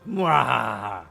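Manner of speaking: villain cackle, loud; mad scientist. Acted. Evil laugh again.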